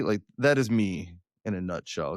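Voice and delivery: ordinary talk, nothing marked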